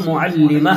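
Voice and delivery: معلمہ ending in a breathed h, no t; pausal h ending. Shown as a demonstration. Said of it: "ہا کی آواز نکال کر کے". (معلمہ کے آخر میں ہا کی آواز)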